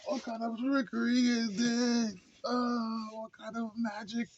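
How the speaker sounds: high, slow, theatrical bewilderment; mock stunned king. Reviewer imitating the father facing magic, high slow theatrical wonder. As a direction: play it like an old king who cannot believe his eyes. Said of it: "He was like".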